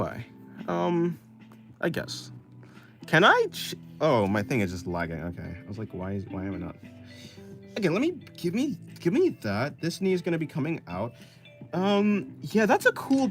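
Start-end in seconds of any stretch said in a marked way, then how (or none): none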